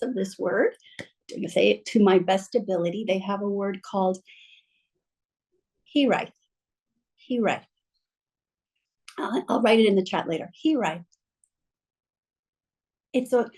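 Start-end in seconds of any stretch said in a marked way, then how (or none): none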